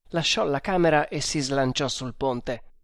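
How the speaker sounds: usual speaking voice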